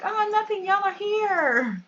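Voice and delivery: high, drawn-out mock mom voice; airy high pitch, stretched at the end; put-on mom greeting. Player voicing mom to her visitors, put-on high greeting.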